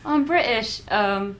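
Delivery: mock posh English accent, half laughing. Shown as a demonstration. Host obliging the actor with an English accent. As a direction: put on a British accent for a joke. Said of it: "I'd like a full phrase".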